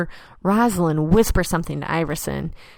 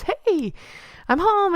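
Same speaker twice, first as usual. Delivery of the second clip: higher, sing-song hello; chirpy greeting; 12 semitones higher, slower than usual. Retold or imitated. Walking in to greet husband Nicholas, bright and high. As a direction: bounce it up brightly. Voicing her cheerful greeting.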